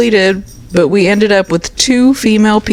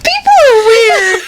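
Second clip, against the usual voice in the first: laughing hard. Real, not acted. Reacting to the potty story, laughing hard. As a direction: say it while losing it laughing.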